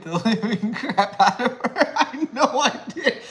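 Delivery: can barely get it out for laughing. Real, not acted. Laughing while telling dog story.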